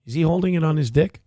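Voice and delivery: quick, disbelieving ask; can't believe what he's seeing; incredulous question. Real, not acted. Streamer watching Hassan's clip, quick incredulous question.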